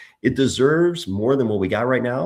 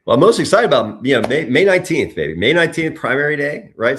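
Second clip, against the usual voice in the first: louder, higher excited burst; 4 semitones higher, 7 dB louder than usual. Real, not acted. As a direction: pump it up for primary day. Excited about primary day.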